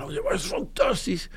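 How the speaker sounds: high-pitched mock enthusiasm, kept soft; mock gushing. Mimics gushing holiday talk.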